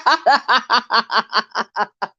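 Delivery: long breathy laughing fit; cackling laughter at the monkeypox line; real laughter. Real, not acted. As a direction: laugh hard at your own joke. Laughing about the news.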